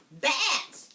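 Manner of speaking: high, loud screech; witch screech. Acted. Witch screeching at the mother.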